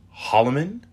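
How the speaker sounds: puzzled Holloman with a sharp upward lift; confused. Acted. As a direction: ask it in disbelief.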